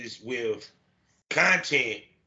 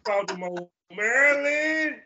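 put-on Baltimore accent; 8 semitones higher, slower than usual. Host showing he knows Baltimore, in Baltimore accent. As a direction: call out the city in its own accent. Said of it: "I hear the Baltimore accent".

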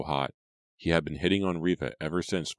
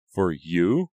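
louder, mocking question; scornful; 2 semitones higher, 6 dB louder than usual. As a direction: sneer at him. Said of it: "Reva demanded with a scornful laugh".

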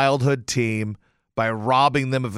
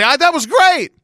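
much higher, louder thrilled cry; excited shout; 13 semitones higher, 8 dB louder than usual. Retold or imitated. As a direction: yell it like stepping off a coaster. Thrilled shout after ride.

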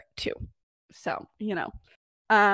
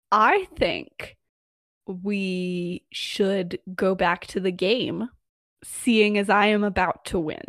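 slow, halting, imitated reluctance; hesitant; 3 semitones lower, slower than usual. Peter dodging the question in front of the card group, hesitant. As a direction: stall between phrases, drawing the words out. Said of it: "he kinda hesitates".

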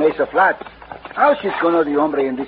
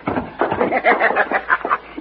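an airy, delighted laugh; gleeful laugh; 5 semitones higher than usual. Acted. Pancho laughs at the storekeeper getting slapped, gleeful. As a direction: laugh with glee at the slap.

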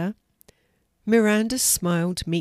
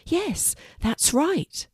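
eager interruption; 10 semitones higher, much slower than usual. A narrator's reading. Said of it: "Miranda interrupted".

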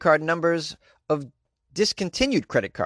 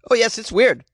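ordinary talk, nothing marked

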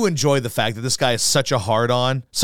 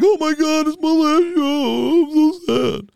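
high, mocking sob-story voice; mock whiny host; 5 dB louder, slower than usual. Host mocks a fired rival's farewell show, high whiny voice. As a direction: whine it like a self-pitying baby. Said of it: "be on the air and be like".

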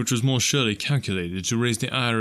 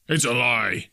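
roaring; 2 semitones higher, slower than usual. Acted. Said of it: "roared the captain".

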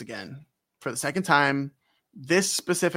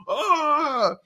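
high, crying groan of despair; anguished groan; 11 semitones higher than usual. Retold or imitated. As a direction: let out a wailing ugh. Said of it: "Steiner's freaking out".

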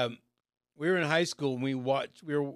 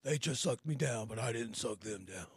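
softer, murmured fake British accent; a half-hearted British accent, quiet; mock British accent; 9 dB quieter than usual. Teasing Keanu's Dracula line in a soft British accent. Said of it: "Keanu Reeves' British accent".

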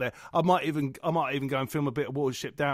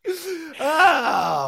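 wheezing high laugh that won't stop; crying with laughter; high helpless laugh; 12 semitones higher, 8 dB louder than usual. Real, not acted. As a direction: lose it completely.

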